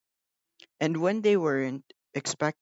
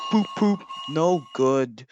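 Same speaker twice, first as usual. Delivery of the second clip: grumbling; 5 dB louder than usual. A narrator's reading. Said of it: "grumbled Gordon".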